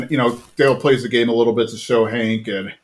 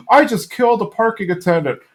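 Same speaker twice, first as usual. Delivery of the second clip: mock panic, high and hurried after a gasp; horrified confession, hammed-up and pitched up; Hank; 8 semitones higher than usual. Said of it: "He's like".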